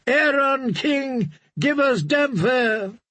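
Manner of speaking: high, slow, distant chant of the drowned men; crowd cry. Acted. Drowned men crying for their priest as king. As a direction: chant for him as your king. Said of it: "they cried".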